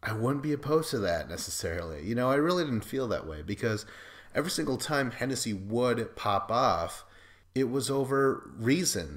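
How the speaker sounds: ordinary talk, nothing marked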